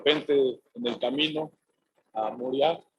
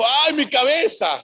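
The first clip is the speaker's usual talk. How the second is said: higher, louder and slow, imitating the boy's groan of pain; mock pained cry; 7 semitones higher, 5 dB louder than usual. The son in the field cries to his father, mock pained. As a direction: act out a sudden headache, hammed-up.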